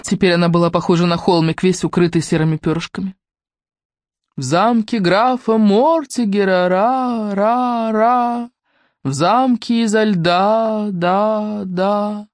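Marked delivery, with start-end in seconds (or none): 4.4-12.3 slow echo, each repeat fading. Acted. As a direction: let the words ring out and die.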